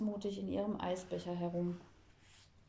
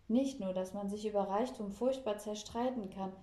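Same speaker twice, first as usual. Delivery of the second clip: plain reading voice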